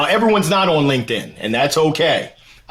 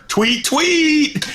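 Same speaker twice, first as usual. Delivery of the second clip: bird tweet; 10 semitones higher than usual. Pretend. Mimicking Twitter birds.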